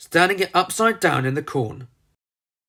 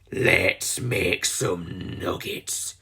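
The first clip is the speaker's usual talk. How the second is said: low, very slow, softer menacing villain snarl; 8 semitones lower, 4 dB quieter, much slower than usual. A narrator's reading. Missus Tweedy snarling over the collar remote, low and slow. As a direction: drag out the threat. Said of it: "she snarled".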